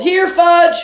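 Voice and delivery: high, loud call; boy calling into the front row. A narrator's reading. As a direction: sing out his name.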